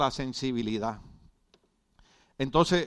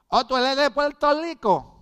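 much higher sing-song guess; mocking know-it-all; 12 semitones higher, 6 dB louder than usual. Preacher imitates a stranger guessing his origin in a high smug voice. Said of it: "me dijo".

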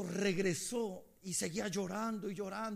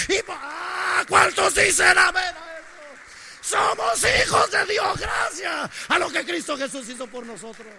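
shouted, slow, rising sermon climax; fiery preaching; 7 semitones higher, 14 dB louder than usual. Acted. Preacher shouting Jesus' words to the congregation, slow and loud. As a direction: build the church to a roar.

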